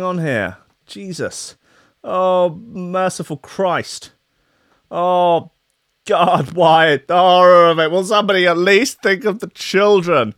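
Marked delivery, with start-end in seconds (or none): 2.0-10.3 hammed-up wail, higher and drawn out; mock despair. Host fighting his computer on air, hammed-up despair. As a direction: plead to the heavens over a broken laptop.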